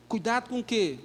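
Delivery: ordinary talk, nothing marked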